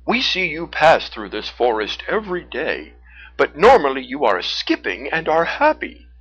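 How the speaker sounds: loud, friendly bear voice; Teddy. A narrator's reading. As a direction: speak up as a big, kindly bear. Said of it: "Teddy the brown bear said".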